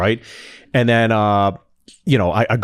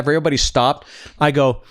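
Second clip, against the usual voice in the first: ordinary talk, nothing marked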